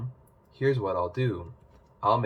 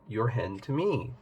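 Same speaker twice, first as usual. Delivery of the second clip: ordinary delivery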